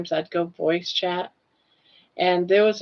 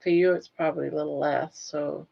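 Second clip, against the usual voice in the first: ordinary talk, nothing marked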